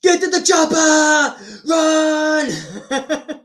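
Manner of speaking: high slow mock shout; Arnold impression. Arnold's chopper line, high slow mock shout. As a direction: bellow it like Arnold. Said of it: "shall I do Arnold?".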